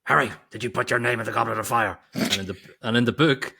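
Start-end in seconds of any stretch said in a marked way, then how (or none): 0.0-2.0 loud, fast, aggressive demand; Michael Gambon. Retold or imitated. Mimicking the movie headmaster confronting Harry, loud and frantic. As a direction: fire it off like an interrogation, hammed up. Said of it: "That's gangster Gambon coming out".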